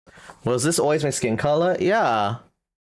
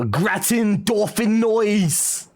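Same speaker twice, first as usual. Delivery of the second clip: gratin dauphinoise slow and higher in hammed-up French; mock French accent; 6 semitones higher, slower than usual. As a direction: savour gratin dauphinoise like a fake chef. Reading French dishes aloud.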